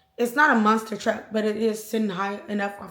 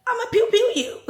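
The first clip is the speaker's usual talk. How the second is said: much higher, quick mocking voice; high-pitched mock threat; 11 semitones higher, faster than usual. Retelling his threatening call to mama, high-pitched mock threat. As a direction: imitate him in a high, silly squeal of a threat.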